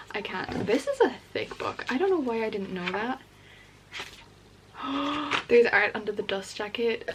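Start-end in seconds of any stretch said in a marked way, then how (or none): 4.8-5.4 quick surprised gasp; sharp gasp. Real, not acted. Spotting hidden art under the dust jacket, a gasp. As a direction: gasp in delight.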